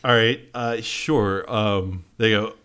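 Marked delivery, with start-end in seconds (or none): none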